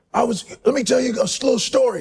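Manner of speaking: high, intense storyteller's bark; David Goggins impression. Goggins jogging in the heat, telling a story in a high bark. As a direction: tell it like a man drill-jogging in the heat. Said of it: "He goes".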